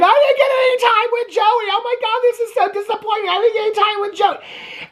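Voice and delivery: high whiny mock sob; mock crying whine. Vlogger mocking a crying contestant, high whiny mock sob. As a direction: whine it like a contestant in hammed-up tears. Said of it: "she's bawling her eyes out".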